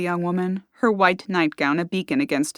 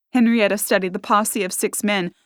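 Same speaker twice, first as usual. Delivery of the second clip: usual speaking voice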